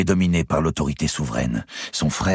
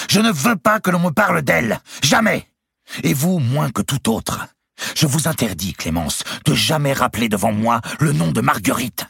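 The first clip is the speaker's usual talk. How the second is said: high, loud forbidding shouts; furious; 13 semitones higher, 4 dB louder than usual. A narrator's reading. Louis forbids Clémence to name Marguerite, furious. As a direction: slam down every word.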